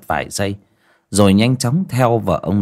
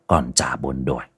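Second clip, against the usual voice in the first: plain reading voice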